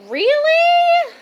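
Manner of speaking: high, loud squeal of disbelief; really, you thought she was hot?; incredulous yelp; shocked disbelief. Real, not acted. Incredulous reaction to crush claim.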